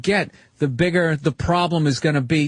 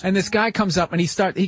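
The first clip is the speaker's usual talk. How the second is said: ordinary talk, nothing marked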